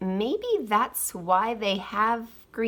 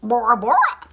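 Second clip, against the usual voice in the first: parrot voice; 4 semitones higher, 5 dB louder than usual. A narrator's reading. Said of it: "said his pirate. Parrot".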